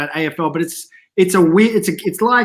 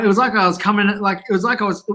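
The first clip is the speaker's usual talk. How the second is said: ordinary talk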